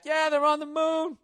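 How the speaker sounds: high, loud, put-on voice of a smug official; mock official voice. Host mocks NASA claiming men reached the moon, in a high put-on voice. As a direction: ham up a phony government spokesman.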